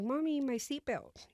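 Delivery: a small boy calling to mom, retold; child's plea. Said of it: "my son was like".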